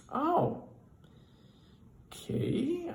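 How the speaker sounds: high, drawn-out uneasy okay; a boss backing off politely; wary boss. Retold or imitated. Boss reacts warily.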